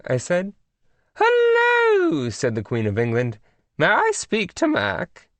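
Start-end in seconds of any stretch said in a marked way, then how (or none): 1.2-2.3 very high, loud regal hello; sing-song high royal greeting; queenly hello. A narrator's reading. Queen of England on phone. 3.8-5.3 high, slow regal English lady; prim high royal request; queenly voice. A narrator's reading. Queen of England on phone.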